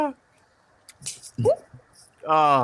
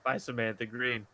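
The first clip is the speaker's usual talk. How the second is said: poem reading; 6 semitones lower, 9 dB quieter than usual. Acted. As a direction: read it like a tender poem. Reads his poem aloud.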